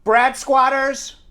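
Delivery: slow Long Island drawl on squatters; Long Island accent. Shown as a demonstration. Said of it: "he says my Long Island accent".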